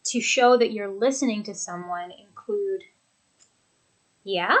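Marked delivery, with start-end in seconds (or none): 4.2-4.6 "Yeah" lifting sharply at the end; engaged, questioning upturn on "Yeah"; rising intonation. Shown as a demonstration. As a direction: say "Yeah" with the pitch going up at the end. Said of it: "You hear it go up at the end".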